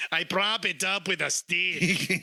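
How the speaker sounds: slow, put-on Spanish accent; retry it with a jokey Spanish accent, drawn out. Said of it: "Yeah, that's better, [name]".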